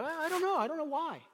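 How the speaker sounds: very high, nervous I don't know; flustered dodge. Pretend. Embarrassed believer dodging question.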